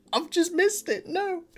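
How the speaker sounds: high-pitched squeal of mock dismay; mock wail. Acted dismay at missing the remix.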